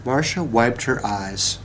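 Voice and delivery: ordinary delivery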